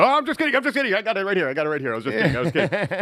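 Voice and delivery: high-pitched, rattled-off retraction; mock frantic backpedaling. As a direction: squeal it out to dodge punishment, easing down slightly. Acted backpedal from bad punishment.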